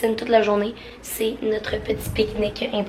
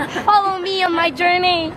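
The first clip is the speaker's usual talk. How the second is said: high dramatic reality-star voice; like a reality show confession; 8 semitones higher than usual. Pretend. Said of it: "je me sens comme dans une télé-réalité".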